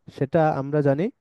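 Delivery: ordinary talk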